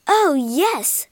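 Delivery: loud, thrilled shout; excited cry. Acted. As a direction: cheer as the ghosts appear. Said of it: "cried Sue".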